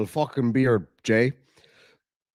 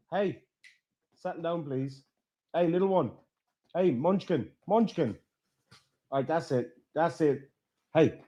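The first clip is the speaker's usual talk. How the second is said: much softer, coaxing the puppy to calm; soft settling pet talk; 7 dB quieter than usual. Real, not acted. Streamer calming a wrestling puppy, soft and coaxing. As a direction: hush the pup gently.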